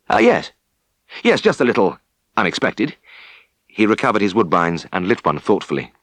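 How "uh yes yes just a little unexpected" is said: flustered. Acted. Tristan flustered after stern call.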